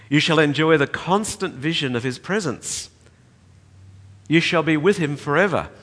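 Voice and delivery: preached quotation, swelling; preaching a quoted promise. Acted. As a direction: build the promise toward heaven. Reads quote in full preaching force.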